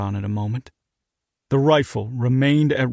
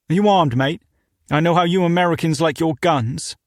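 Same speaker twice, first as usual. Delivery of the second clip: put-on British accent; 4 semitones higher, 3 dB louder than usual. British owner questioning.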